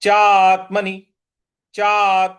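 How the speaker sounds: चात्मनि with the त pushed hard and loud; stress on the half त. Shown as a demonstration. Teacher drills students on stressing चात्मनि. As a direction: press on त in चात्मनि. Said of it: "उस पर हमको आघात देना है".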